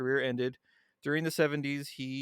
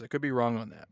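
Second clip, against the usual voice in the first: ordinary talk, nothing marked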